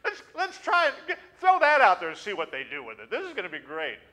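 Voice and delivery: high, laughing gleeful angel voice; preacher acting out gleeful angels at play; giggling angels. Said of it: "they're all up there just".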